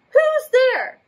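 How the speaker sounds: high squeaky call; mouse crying out. Acted. The mouse calling into the dark cave. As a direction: squeak it out in surprise. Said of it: "Mouse cries".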